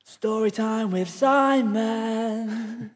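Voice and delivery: high, very slow sung-out show jingle. Pretend. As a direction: announce it like a radio jingle. Jingle-style show segment intro.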